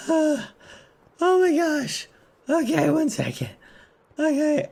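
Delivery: sigh, then high worn-out little voice; mock exhaustion. Lost streamer with aching legs, mock exhausted. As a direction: play being wiped out, pitched high.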